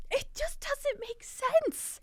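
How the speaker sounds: high, slow disbelief; incredulous. Real, not acted. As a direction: stretch it out in disbelief. Incredulous exclaim.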